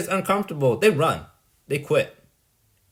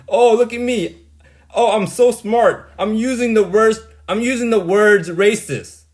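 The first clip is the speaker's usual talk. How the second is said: louder, higher sneering mock of a self-important know-it-all; loud, high mocking voice imitating a pompous friend; mocking Min; 3 semitones higher, 5 dB louder than usual. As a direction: ham up a smug show-off who loves his big words. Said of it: "That's what Min looks like".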